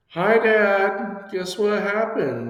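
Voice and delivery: high, sweet tattletale voice, drawn out; sing-song tattling sister. Retold or imitated. Sister sing-songs to dad, high tattletale voice. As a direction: chirp it up high like a little sister telling on you.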